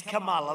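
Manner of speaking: Kamala said as "comma-la", stress on the first syllable; correct pronunciation. Shown as a demonstration. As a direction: say Kamala like the word comma plus la. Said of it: "You have to pronounce her name right".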